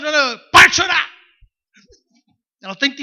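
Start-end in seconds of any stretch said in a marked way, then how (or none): 0.5-1.1 loud, high mock scold; scolding a crying child. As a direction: snap at the child to stop crying. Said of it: "Ana Clara chorando".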